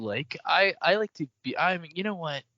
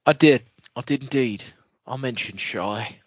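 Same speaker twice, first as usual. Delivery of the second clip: put-on Wayne's accent; 6 semitones lower than usual. Cohost drops into a mild Aussie accent for Wayne. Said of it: "Don't know why I'm doing this accent".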